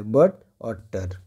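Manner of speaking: slow, syllable by syllable "but" then "टर"; teacher slowly splitting "but और टर" into its two syllables; split into syllables. Shown as a demonstration. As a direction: break "but और टर" apart slowly, one syllable at a time. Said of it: "तो इसमें दो syllable है".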